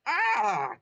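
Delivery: loud, high yelp of pain; pained wince. Acted. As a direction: yelp as the leg hurts. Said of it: "Toad winced".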